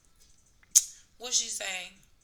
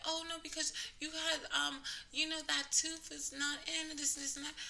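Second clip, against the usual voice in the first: higher, sing-song imitation of a salesperson; mock sweet staffer; 8 semitones higher than usual. Sales coordinator justifies the extra charge, higher mock-sweet voice. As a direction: make her sound sugary and evasive.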